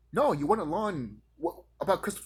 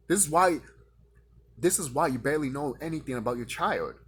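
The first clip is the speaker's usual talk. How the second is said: ordinary talk, nothing marked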